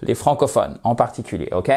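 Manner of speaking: normal talking voice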